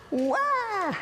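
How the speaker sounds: high mock baby wail. Mother imitates baby Peter crying when put down, a high wail. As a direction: imitate a baby's sudden cry.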